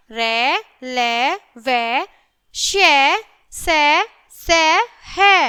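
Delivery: put-on slow, sing-song letter by letter recitation for children; reciting the consonants. Shown as a demonstration. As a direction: chant each consonant clearly for the class to repeat. Teacher recites the consonants aloud.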